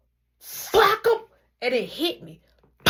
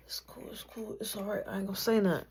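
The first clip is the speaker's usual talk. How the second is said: soft whispered it's cool; quietly calm him down; hushed reassurance; 8 dB quieter, faster than usual. Retold or imitated. Hurt boy reassuring his brother, softly.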